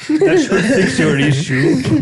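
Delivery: mock Indian accent. Tech support agent bit.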